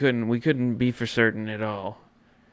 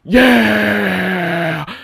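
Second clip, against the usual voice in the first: higher, louder joyous whooping yeah; cheering yell; 9 semitones higher, 12 dB louder than usual. Retold or imitated. Host to fans, imitating the crowd's cheering yell. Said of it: "We're going".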